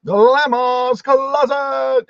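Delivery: slow, drawn-out, high and loud name call; hyped announcer reveal; announcer intro. Acted. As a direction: stretch the name out like a game-show announcer. Host announces guest channel name.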